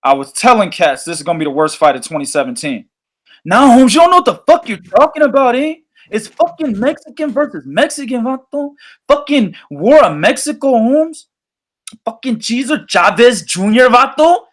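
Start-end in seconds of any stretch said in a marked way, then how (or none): 3.4-14.4 hammed-up Chicano slang voice, calling him Holmes and vato; imitating a Mexican boxing fan in a put-on Mexican-American accent; playful Mexican-American accent, a fan scolding the doubter; mock Mexican-American accent. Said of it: "That's how they talk".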